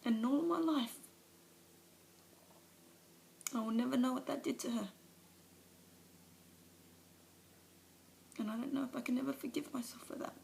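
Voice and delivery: Alice breaking down before the meeting; tearful confession. Acted. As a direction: let the shame and grief spill over. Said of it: "a monologue from one of, of her movies".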